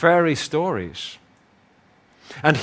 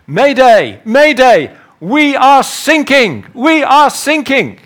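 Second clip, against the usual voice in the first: high, loud, slowed mayday shout; distress call; 12 semitones higher, 11 dB louder, slower than usual. Retold or imitated. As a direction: yell it like a sinking ship's radio call. Voice calls distress over radio.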